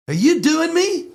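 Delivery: high-pitched challenge; squeaky, suspicious movie-star impression; Joe Pesci. Star confronting a fan he thinks mocks him, high-pitched impression. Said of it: "He looked me dead in the eye".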